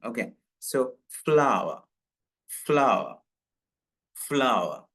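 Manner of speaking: ഫ്ലവർ in two syllables like flower, final r silent; correct pronunciation. Shown as a demonstration. Teacher modelling the right form for students, ഫ്ലവർ with silent r. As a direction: say ഫ്ലവർ as flau-uh, no r. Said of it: "last ആറ് ശരിക്കും അങ്ങോട്ട് പറയില്ല".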